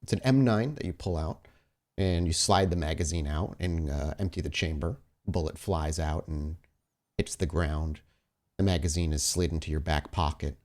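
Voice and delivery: ordinary talk, nothing marked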